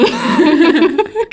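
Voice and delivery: high giggling burst; real high-pitched laughing fit. Real, not acted. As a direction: crack up at a silly name. Cracks up at nickname.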